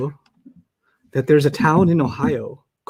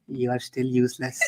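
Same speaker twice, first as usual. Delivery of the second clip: imitated manager; 5 semitones lower, 9 dB quieter than usual. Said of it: "He goes".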